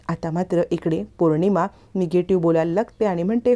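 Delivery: usual speaking voice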